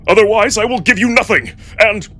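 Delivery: defiant outburst. Acted. Marco threatening Cato with the dungeon, a fast defiant outburst. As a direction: blurt the threat in a rush.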